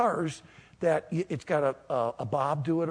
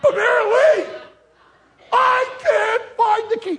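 loud, high mock wailing; crying; 10 dB louder than usual. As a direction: bawl to your wife in hammed-up despair. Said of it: "I start crying".

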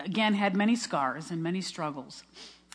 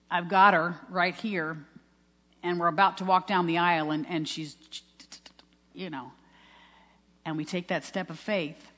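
ordinary talk, nothing marked

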